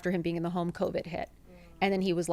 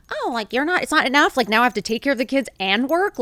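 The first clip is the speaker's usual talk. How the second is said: higher, louder mock-indignant protest; retold defensive outburst, pitched up; 4 semitones higher, 5 dB louder than usual. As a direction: push back as if unfairly put upon. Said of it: "I would get defensive".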